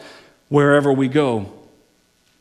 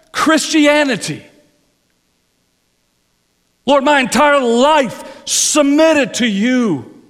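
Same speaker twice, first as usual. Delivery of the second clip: higher, louder, slowing on key words; impassioned preaching; 9 semitones higher, 5 dB louder than usual. Acted. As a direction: preach the Lord's will with full fervour. Preacher voicing James and prayer.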